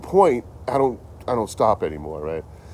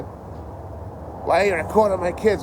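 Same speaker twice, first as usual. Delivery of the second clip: angry neighbour; 11 semitones higher, slower than usual. Retold or imitated. Angry neighbour voiced in retelling.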